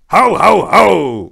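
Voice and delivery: loud, high jolly ho ho ho; belly-laugh like Santa; Santa's chortle. Acted. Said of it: "chortled Santa".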